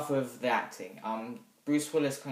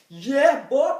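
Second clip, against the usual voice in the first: much higher and louder mock whoop; whooping yell; 13 semitones higher, 12 dB louder than usual. Reviewer acting out the running hero with a loud high whoop. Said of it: "running like this and going".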